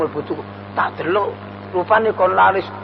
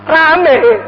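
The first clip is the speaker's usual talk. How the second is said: Rame shouted high and loud like a hawker; hammed-up hawker cry of Rame; loud, high call of rame; 6 semitones higher, 6 dB louder than usual. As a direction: call out Rame above the market din. Mimics busy market.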